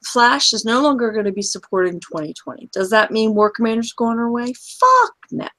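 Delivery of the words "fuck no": scoffing shout. Real, not acted. Scoffing at rumour.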